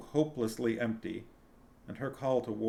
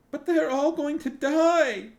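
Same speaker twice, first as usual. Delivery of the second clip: high, loud, slow wail; 9 dB louder, slower than usual. A narrator's reading. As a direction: cry it out in anguish. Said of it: "Sinedra wailed".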